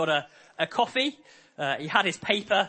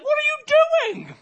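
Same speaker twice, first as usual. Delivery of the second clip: outraged cry; 6 dB louder than usual. Retold or imitated. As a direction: shriek in disbelief at the thief. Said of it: "He's like".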